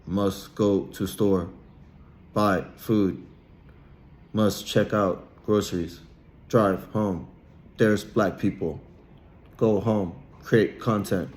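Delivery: halting, robotic delivery in short chunks; mock a crude thinker, plodding phrase by phrase; mock simpleton inner monologue. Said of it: "their inner monologue is probably like".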